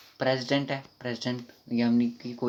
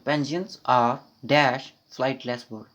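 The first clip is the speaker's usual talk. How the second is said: slow reading aloud; 5 dB louder, much slower than usual. Shown as a demonstration. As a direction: read it very slowly, word by word. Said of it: "reading the question slowly".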